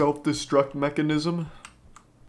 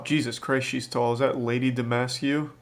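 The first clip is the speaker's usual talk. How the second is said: ordinary talk, nothing marked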